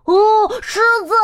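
high loud alarm call; playful high shout of warning. A narrator's reading. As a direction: cry out a warning about the lion. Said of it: "两个家伙大叫".

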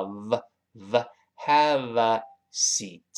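"have a" linked with a clear v, slow. Shown as a demonstration. Teacher drills the linked "have a seat", slow. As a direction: bite the lip on the v of "have". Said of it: "上牙触下唇发v".

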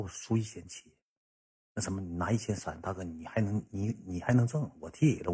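hushed, hesitant, sorry-for-himself plea. Retold or imitated. Yuntao asking big brother to cover half, imitated meek plea. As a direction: plead poverty softly, with small breaks. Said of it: "云涛上我跟前这么跟我说句话".

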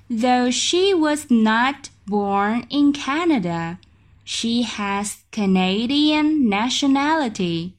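very slow, clear learner reading; slow teacher demo; slow model reading. Shown as a demonstration. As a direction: read it out slowly and clearly. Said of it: "slow third reading".

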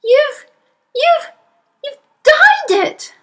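very high, loud, halting shriek; shrieking mother. Acted. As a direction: stammer the start, then shriek in horror. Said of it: "shrieked the mother".

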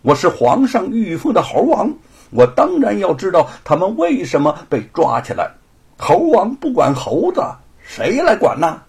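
a scholar's flaring indignation; indignant anger. Acted. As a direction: stand up for the monkeys with rising anger. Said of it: "吴承恩气往上涌".

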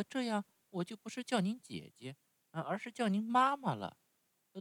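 高出许多的稚嫩童声，带着依恋; 像小木偶一样怯生生地撒娇; 木偶孩子的声音. Acted. Narrator voices Pinocchio. (用高高的童声扮演匹诺乔)

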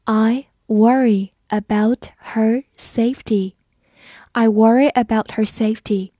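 slow, careful sentence for learners; slow read. Shown as a demonstration. Teacher reading slowly to students, careful and clear. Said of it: "好，我们慢读一遍".

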